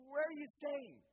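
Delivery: mock-flustered, high, loud outburst; panicked blurt. Disciple caught in an awkward silence before Jesus, blurting in panic. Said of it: "one of them blurts out".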